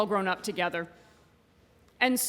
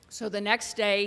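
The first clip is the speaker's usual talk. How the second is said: ordinary delivery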